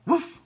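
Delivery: short, high, loud imitated bark; dog woof. A narrator's reading. Ebb praised by Flo, high woof. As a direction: yap one quick woof. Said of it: "said Ebb".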